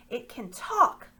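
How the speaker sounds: high, loud astonished cry; amazed exclamation. Acted. The slaver discovers the mouse talks, loudly astonished. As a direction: gasp it out in greedy wonder.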